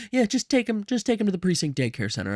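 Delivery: high start, tumbling down quickly; hurried, high-pitched deflection; flustered brush-off. Acted. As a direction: rattle it off high, passing the job along. Flustered handing off of child.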